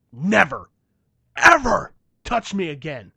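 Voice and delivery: a slow, loud final warning. Retold or imitated. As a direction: lean on every word and raise the volume. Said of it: "I said".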